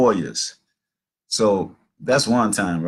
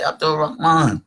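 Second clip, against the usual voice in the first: high, carrying call through a window; eager shout of greeting; calling out a name; 8 semitones higher, 3 dB louder than usual. Retold or imitated. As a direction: call his name to get him to the door.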